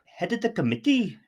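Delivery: put-on high-pitched, hammy Welsh accent. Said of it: "that's my Welsh accent".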